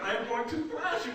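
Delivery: mock British trash talk; put-on, prim British accent. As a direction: imitate an uppity Brit making a threat. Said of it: "he's talking with that British accent".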